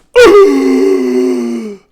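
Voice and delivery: loud, high, long sucking gasp; full gasp. Acted. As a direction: gasp as if jolted back to life. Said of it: "sits up with a full gasp".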